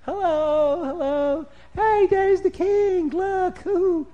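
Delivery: high, loud cheering townsfolk; high delighted crowd calls; excited crowd. Acted. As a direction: call out to the passing king.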